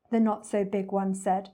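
plain reading voice

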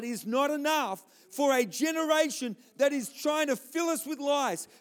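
high, loud, driving sermon voice; full-force preaching. Real, not acted. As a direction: hammer each phrase home to the congregation.

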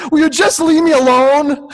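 high, loud, exasperated outburst in a put-on voice; student yelling back at the nagging teacher; angry yell. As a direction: shout it at the teacher to make her back off. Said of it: "the guy just gets up and he goes".

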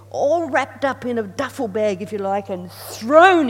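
loud, driving sermon delivery; emphatic preaching. Real, not acted. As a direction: preach it out with force. Preacher emphatic at full force.

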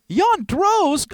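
high, loud, incredulous cry; aghast, pitch way up; shocked repeat. Acted. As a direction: yelp the name in disbelief.

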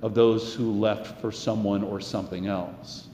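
earnest, rising preacher's cadence; sermon delivery. Acted. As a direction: preach it to the congregation.

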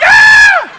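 very high, very loud mock shriek; hammed-up yell of terror; imitated panicked scream. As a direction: scream as if the doll came alive. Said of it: "And I'm like".

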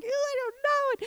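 high, squeamish whine; craving voice balking, high and whiny; whiny reluctance. Retold or imitated. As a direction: sound grossed out and unsure. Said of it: "And I'm like".

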